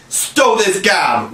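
put-on pirate captain silencing the boys; roaring. A narrator's reading. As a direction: cut them off with a roar. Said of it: "roared Hook".

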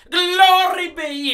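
put-on high, loud old lady outcry; exasperated cry. Old lady crying out at the goat chaos, loud and high. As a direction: throw up your hands and cry out. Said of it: "The little old lady cried".

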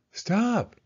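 sharp high cry to halt the car; cried out. Acted. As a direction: yell stop, up high. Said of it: "cried Carl".

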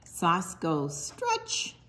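ordinary delivery

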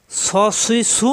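স, সুই, সু thick and rounded; full, heavy স, সুই, সু. Shown as a demonstration. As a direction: fill out the s in স, সুই, সু. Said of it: "মোটা".

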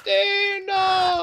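tearful shout. Pretend. Mock tearful reunion call.

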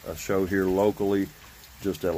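usual speaking voice

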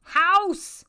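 loud excited shouting, high; Mayor Tinsel shouting. Acted. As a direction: yell it out like the mayor. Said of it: "Mayor Tinsel says".

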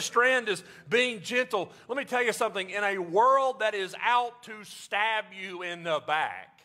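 high, ringing preacher voice; preaching at full force. Acted. Pastor preaching to congregation about gentleness, high and ringing. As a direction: drive each line home to the pews.

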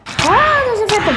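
high, loud startled cry; startled alarm. Real, not acted. Player suddenly spots a phantom flying in, real alarm. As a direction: yelp at a sudden attacker.

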